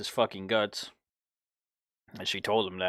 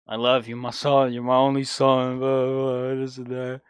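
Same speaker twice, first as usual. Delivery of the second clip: loud, mocking impression of a weepy drunk father; imitating drunk crying dad; 2 semitones higher, 6 dB louder than usual. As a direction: ham up the drunk dad's tearful call. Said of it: "And he would fucking cry".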